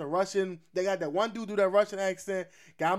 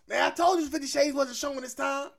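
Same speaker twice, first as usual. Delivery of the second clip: higher-pitched mock grumbling; mock complaint; 6 semitones higher than usual. Tricked moviegoer telling their partner about Fifty Shades, mock complaint. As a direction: act out a put-out moviegoer complaining.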